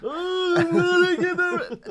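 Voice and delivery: huge, loud, high-pitched fake yawn; mock yawning. Shown as a demonstration. Teacher demonstrating the killing curse in a loud mock yawn. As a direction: yawn right through the words. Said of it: "sound like you're in the middle of a yawn".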